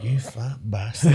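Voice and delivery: slow, teasing insult; drawn-out jokey jab; a playful slow insult; mock insult. Mock insult quoted to friend.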